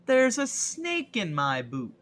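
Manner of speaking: high, slow, loud toy-cowboy cry; hammed-up exclamation; mock alarm catchphrase. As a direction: call it out big and drawn out.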